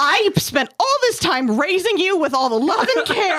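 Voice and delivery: high, laughing scolding parent; hammed-up wounded parent; indignant parent. Parent scolds ungrateful child.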